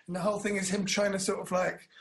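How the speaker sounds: ordinary talk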